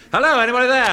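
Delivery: high, loud call through letterbox. Acted. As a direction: call out into the house.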